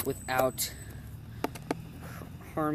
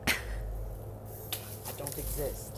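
whispered; 9 dB quieter, much slower than usual. Pretend. Dodging the camera.

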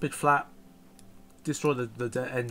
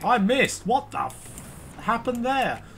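high, startled outburst at a missed shot; real shock, voice jumping up; stunned disbelief; 7 semitones higher, 3 dB louder, much slower than usual. Real, not acted.

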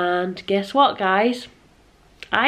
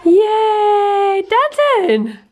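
excited cheering; 10 semitones higher, 4 dB louder, much slower than usual. Real, not acted. Cheering baby's dancing.

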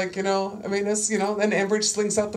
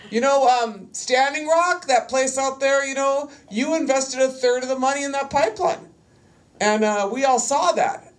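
higher, firm mom voice laying down the charges; big girl voice; 7 semitones higher than usual. Retold or imitated. Activist confronting Enbridge at meeting, firm mom voice. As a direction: speak up like a mom holding them to account. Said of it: "I use my big girl voice".

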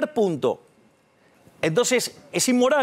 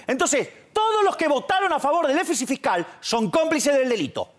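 usual speaking voice

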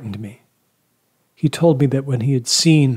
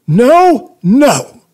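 shouted interruption; 14 semitones higher, 10 dB louder than usual. A narrator's reading. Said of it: "he interrupted".

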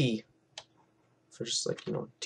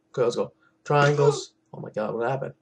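usual speaking voice

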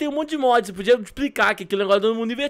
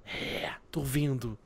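slow, low whisper; playful whisper; 6 semitones lower, 10 dB quieter, much slower than usual. Pretend. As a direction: whisper it slowly, keeping the secret. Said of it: "é pra falar ba-, pra baixinho". (sussurro lento e baixinho)

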